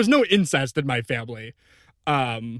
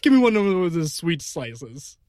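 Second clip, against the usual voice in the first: hungry pizza guy; 8 semitones higher, 4 dB louder than usual. Acted. Improv character begging for pizza.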